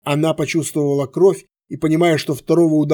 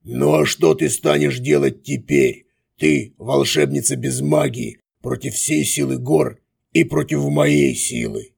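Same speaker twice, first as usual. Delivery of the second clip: disembodied voice. A narrator's reading. Villain voice from darkness.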